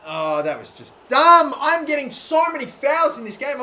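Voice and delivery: loud, slow, fed-up groan into a high complaint; annoyed outburst. Real, not acted. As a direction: vent at the referee. Complaining about fouls.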